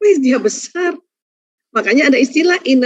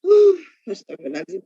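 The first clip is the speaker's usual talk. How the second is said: sighing istighfar; 4 semitones lower, 3 dB quieter than usual. Shown as a demonstration. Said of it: "Pas ibu istighfar sampai ibu nangis".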